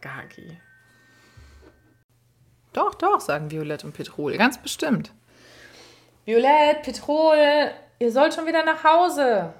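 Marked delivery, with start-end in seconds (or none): 2.7-3.2 high, loud, bouncing insistence; two kids chiming in at once; eager insisting. A narrator's reading. Children insisting to Kaki, high eager voices. 4.4-5.1 eager insisting. A narrator's reading. Children insist together. 6.2-9.5 high, loud call across the house. A narrator's reading. Parent at the door calling the kids home, loud high call.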